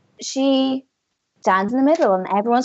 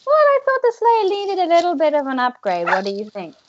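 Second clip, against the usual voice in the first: higher, lilting character voice; pitched up, gliding down through the sentence; Missy's playful boast; 5 semitones higher, faster than usual. Acted. Missy shows off her motorbike to the heroes, high playful boast. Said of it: "She goes".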